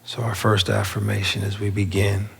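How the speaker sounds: unhurried meditation guide with long pauses; spacious, measured affirmation leading; guided meditation. Real, not acted. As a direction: guide them inward, phrase by phrase. Leads a seated meditation.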